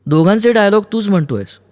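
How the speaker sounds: high-pitched heated complaint; indignant accusation. Acted. Kaumudi accuses Aruni of speaking both dialogues, indignant.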